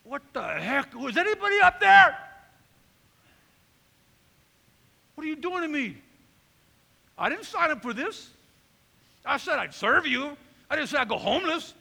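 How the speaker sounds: loud, high, indignant outburst at God; angry at God. Retold or imitated. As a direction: shout up at heaven, feeling betrayed. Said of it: "I'm getting mad at God".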